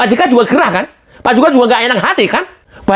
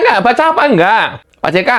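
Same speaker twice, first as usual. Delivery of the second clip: ordinary talk, nothing marked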